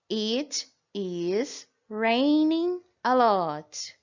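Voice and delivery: very slow, clear English sentence; slow English demo. Shown as a demonstration. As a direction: spell it out for the class. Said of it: "Por exemplo".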